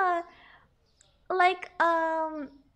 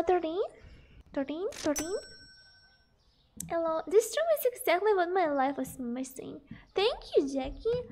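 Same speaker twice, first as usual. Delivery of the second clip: ordinary talk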